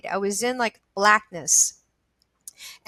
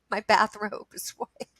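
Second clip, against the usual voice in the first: ordinary delivery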